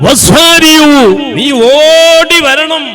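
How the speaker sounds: high, loud pleading cry; urgent call. Acted. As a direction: call them to come running. Preacher urging listeners to hasten.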